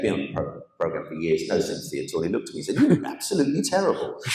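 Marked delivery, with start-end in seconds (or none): 2.8-4.2 high, unsympathetic director's verdict; blunt director. Retold or imitated. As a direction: voice the unimpressed director, pitched up. Blunt director voiced in story.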